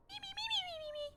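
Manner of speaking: high, soft whimpering me's; mock wailing. Real, not acted. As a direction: whine it out, heartbroken.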